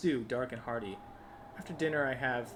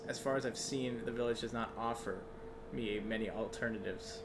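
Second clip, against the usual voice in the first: normal talking voice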